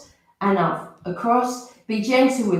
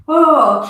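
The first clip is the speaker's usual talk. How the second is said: higher, louder sighing oh; audible exhale; 5 semitones higher, 8 dB louder than usual. Shown as a demonstration. Teacher modelling the exhale while rolling the feet, loud high sigh. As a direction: push the breath out with a voiced oh. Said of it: "keep your breath".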